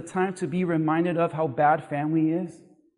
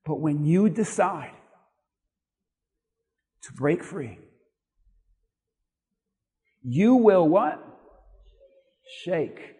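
gentle father's blessing; 3 semitones lower than usual. Acted. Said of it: "Notice what he says".

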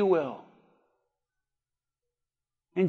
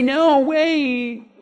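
imitating pleading; 4 semitones higher than usual. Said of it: "Elijah didn't say".

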